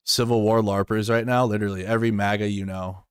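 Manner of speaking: ordinary talk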